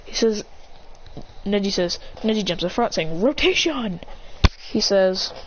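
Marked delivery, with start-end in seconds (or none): 3.2-4.0 higher, stretched battle call; attack cry. Acted. Neji jumping in front, calling his move.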